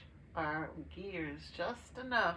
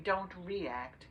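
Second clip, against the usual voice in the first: ordinary delivery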